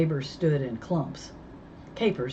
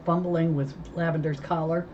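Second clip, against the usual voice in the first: ordinary delivery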